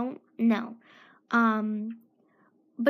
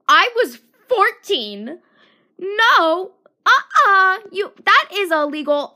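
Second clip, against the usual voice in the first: much louder, higher outraged protest; indignant shout; 6 semitones higher, 10 dB louder than usual. Real, not acted. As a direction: shout it in disbelief. Indignant outburst about catcallers.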